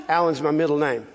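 usual speaking voice

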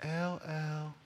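put-on soft, high child's far-away call; tiny high sing-out; little girl calling. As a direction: imitate a small girl calling a nickname from down the street. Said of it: "and she would say".

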